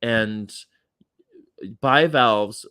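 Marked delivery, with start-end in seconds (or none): none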